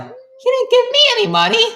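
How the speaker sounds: very high, loud falsetto complaint; mock high-pitched gripe; squeaky whine. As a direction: whine like a jealous kid. Red Boy complaining.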